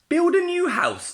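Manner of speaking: very high, loud order; shrieking Missus Porker. A narrator's reading. Missus Porker orders Brenda, very high and loud. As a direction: squeal the command.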